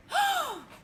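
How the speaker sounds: sharp high gasp of fright; gasp, startled and scared; frightened gasp. A narrator's reading. The friends see a huge shadow, sharp frightened gasp. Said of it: "The friends all gasped with fright".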